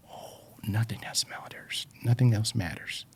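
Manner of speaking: soft breathy whisper, dropping right off; hushed whisper. Real, not acted. Describing being lost in his passion, in a hushed whisper. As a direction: whisper it like nothing else exists.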